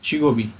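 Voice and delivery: छिगोबी said as one word, ब joined to ई; linked reading. Shown as a demonstration. Teacher models छिगोबी for the class. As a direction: say छिगोबी smoothly, not छिगो ई. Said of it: "इसको पढ़ेंगे छिगोबी".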